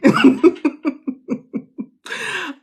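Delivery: high, loud laugh breaking out; real burst of laughter. Real, not acted. Coach laughing at her own idea, a real high laugh. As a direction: crack up at her own thought. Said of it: "I'm laughing".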